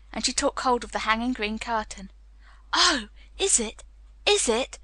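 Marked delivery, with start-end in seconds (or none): 2.7-4.8 slightly breathless wonder; higher, awed little gasps; gasped. A narrator's reading. Colin seeing the hidden ivy wall, gasped. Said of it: "gasped Colin".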